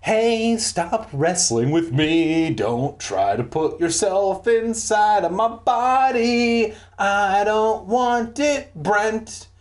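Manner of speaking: high, drawn-out stagey pleading; hammed-up, slow high-pitched protest; mock play rehearsal. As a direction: declaim it like overdone school-play lines. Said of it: "rehearsing my lines for the school play".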